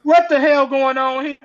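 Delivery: loud, high shout; angry shout. Retold or imitated. Husband storms in angry shouting. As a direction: bark at the council.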